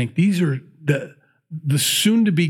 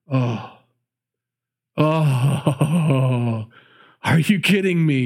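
mother weeping with joy over her son; overcome, half crying; tearful joy; much slower than usual. Acted. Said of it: "You can just hear her".

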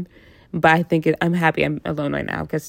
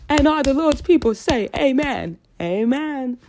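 preacher call; 8 semitones higher than usual. Pretend. Church call and response.

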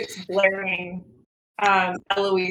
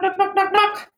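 mock knocking; 10 semitones higher, 3 dB louder than usual. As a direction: knock knock said high and loud. Knocking at the door.

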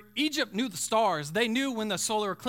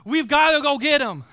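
louder, higher urgent plea; angels' urgent cry; 6 semitones higher, 11 dB louder than usual. Acted. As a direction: shout it out, straining to rush in. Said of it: "angels were on the edge ... going".

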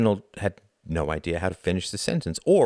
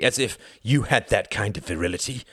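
higher, scornful jab; bitter, mocking dismissal; harsh scorn; 4 semitones higher, 4 dB louder than usual. A narrator's reading. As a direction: throw it out with harsh derision. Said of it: "laughed harshly".

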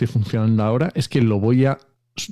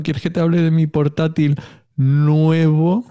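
ironic, slow, higher air-quote tone; 6 semitones higher, 3 dB louder, slower than usual. Pretend. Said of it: "estoy haciendo comillas con los dedos".

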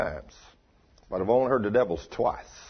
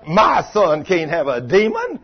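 lightly voiced mother, loud and drawn out; slow, louder mock protest of a mother; indignant mother; 5 semitones higher, 7 dB louder than usual. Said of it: "what the average mother would say".

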